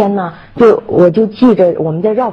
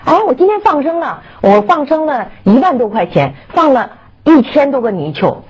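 high-pitched mock bragging; mimicked boasting; 6 semitones higher than usual. Mocking people who boast of releasing animals, high self-satisfied voice. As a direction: show off proudly, pitched well up.

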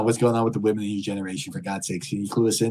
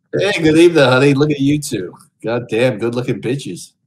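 loud, high leering lounge-lizard greeting; sleazy greeter; 4 semitones higher, 9 dB louder than usual. Acted. Streamer greets Omegle strangers as a leering creep, loud and high. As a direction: belt it out like a creepy host hitting on strangers.

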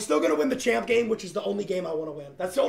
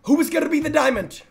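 announcer; 7 semitones higher than usual. Acted. Hype announcer question.

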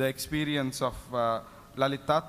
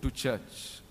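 slow, lower inner prompting; 4 semitones lower, 4 dB quieter, slower than usual. Retold or imitated. Voice inside a drunk man on the road, slow and low. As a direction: say it low and measured, like a thought. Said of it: "a voice that said inside him".

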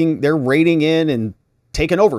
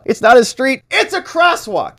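shouted objection; 11 semitones higher, 3 dB louder than usual. Retold or imitated. Defense lawyer shouting at the witness, loud and high. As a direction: shout it across the courtroom, indignant. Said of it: "She's shouting it out".

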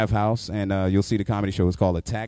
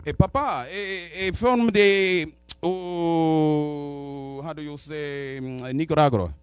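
put-on halting broken English with a Spanish accent; mock Spanish-accented English, searching for words; 4 semitones higher, much slower than usual. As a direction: fumble for words like a Spanish speaker. Imitating Spanish-speaking man.

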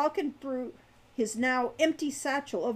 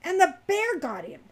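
louder, higher shocked realization; sudden understanding; 8 semitones higher, 6 dB louder than usual. A narrator's reading. Hawk realizing the baronet's fate, suddenly understanding. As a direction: land the awful conclusion. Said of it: "suddenly understanding".

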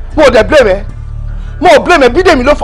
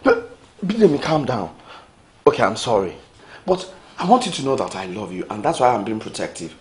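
much softer, lower, tender apology; soft pleading; 10 semitones lower, 11 dB quieter than usual. Acted. Femi softly begging Bidemi not to leave, tender. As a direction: beg her gently to calm down.

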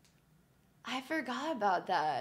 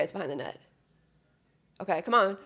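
ordinary delivery

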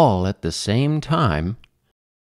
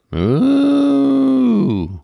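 high, loud drawn-out moo; cow mooing; 5 dB louder than usual. A narrator's reading. Cow calling the sleeping farmer, mooing. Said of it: "When the cow said".

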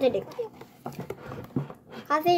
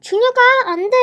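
loud, high warning shout; mom shouting; 9 semitones higher, 7 dB louder, faster than usual. Acted. Mom yelling at 준혁 on the cart, alarmed. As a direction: stop him before he crashes.

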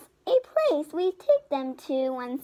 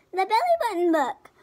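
title flourish; 5 semitones higher, 3 dB louder, faster than usual. A narrator's reading.

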